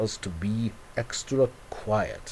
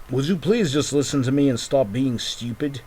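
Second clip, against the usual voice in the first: ordinary delivery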